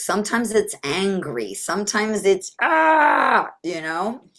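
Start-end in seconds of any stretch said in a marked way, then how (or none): 2.6-3.5 high, loud "aah" of frustration; short put-on scream, pitched way up; mock scream. Shown as a demonstration. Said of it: "Sometimes it screams".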